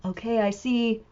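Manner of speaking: usual speaking voice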